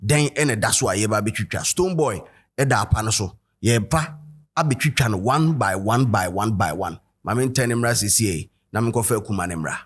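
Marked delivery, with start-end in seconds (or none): none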